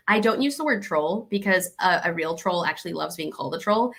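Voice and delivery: ordinary talk, nothing marked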